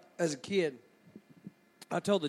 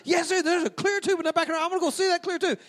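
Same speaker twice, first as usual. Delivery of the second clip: put-on very high, hurried little-boy protest; insistent kid; 10 semitones higher, faster than usual. Small boy pestering mom in church, high-pitched insistence. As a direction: argue back high and fast, refusing to drop it. Child insists in argument with mother.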